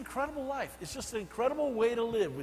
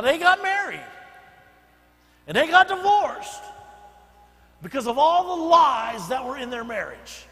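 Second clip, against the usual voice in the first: loud, higher sermon build; full-force preaching; 8 semitones higher, 10 dB louder than usual. Acted. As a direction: drive the warning home to the congregation. Preaching the warning.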